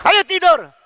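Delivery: put-on high, loud command to a child; sudden sharp shout; mock scolding parent. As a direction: imitate an exasperated parent ordering bedtime. Parent hitting child to sleep.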